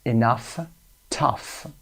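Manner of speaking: "Enough", "tough", each ending in a clear UFF; OUGH as UFF. Shown as a demonstration. Teacher sums up the sound for learners, OUGH as UFF. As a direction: separate the words and sound GH as F. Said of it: "صدای آف رو گرفته".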